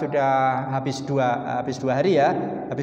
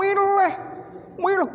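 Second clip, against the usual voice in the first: choosing. Retold or imitated. Diner torn between dishes.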